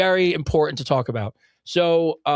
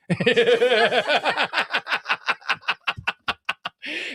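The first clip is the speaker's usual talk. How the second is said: giggles run up high and bubble over; long giggling laugh; 6 semitones higher than usual. Real, not acted. Laughing hard at his own dick-chip joke.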